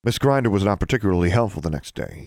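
usual speaking voice

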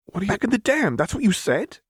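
can't believe what he just heard; stunned disbelief. A narrator's reading. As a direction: jaw dropped, voice shooting up. Echo stunned by Lux's words.

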